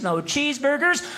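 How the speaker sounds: ordinary talk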